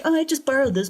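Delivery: high, playful fake-innocent voice; mock innocence. Host mocking the theft by unlawful taking charge, breezy innocence. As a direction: play the thief casually excusing it.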